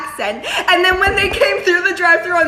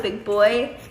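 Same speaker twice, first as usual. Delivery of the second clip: low sultry flirting at the window; 7 semitones lower, 6 dB quieter than usual.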